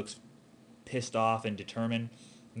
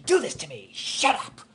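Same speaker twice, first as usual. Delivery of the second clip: louder, pushed climax shout; big climactic yell; 5 semitones higher, 7 dB louder than usual. Shown as a demonstration. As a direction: hit the peak of the scene, really push it. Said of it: "it has to be something big".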